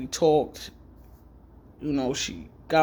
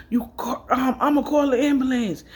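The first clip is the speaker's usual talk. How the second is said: panicked mother; 8 semitones higher, 4 dB louder than usual. Retold or imitated. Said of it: "She was like".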